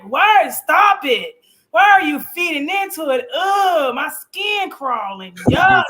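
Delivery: loud, high cry of revulsion, drawn out; disgusted mock outburst. Co-host mimicking a husband's rant at his wife, disgusted. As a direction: imitate him recoiling, big and hammed-up.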